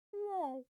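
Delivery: a deflated high yelp; sad whoa. Retold or imitated. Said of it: "Or sometimes he's like".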